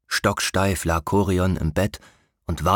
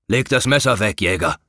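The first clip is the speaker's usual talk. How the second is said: louder, firm royal order; commanding king; 4 dB louder than usual. A narrator's reading. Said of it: "befahl König Garion". (befehlender König)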